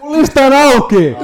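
high, slow, loud squeal of protest; exasperated protest. Real, not acted. Friend told to open his list, exasperated high protest. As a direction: protest in a drawn-out high yell.

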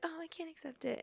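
high, soft little refusal; mock timid nerd girl. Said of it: "And she's like".

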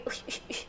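whispered shoo. Retold or imitated. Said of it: "paling aku kayak".

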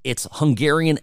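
ordinary talk, nothing marked

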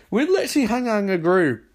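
fast, high-pitched objection; mock exasperation. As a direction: rattle it off in squeaky disbelief. Group objects to Ray.

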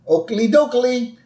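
high cheery Flanders greeting; Ned Flanders voice. Retold or imitated. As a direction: chirp it like Flanders. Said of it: "Flanders says".

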